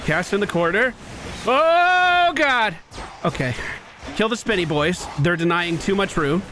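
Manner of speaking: ordinary talk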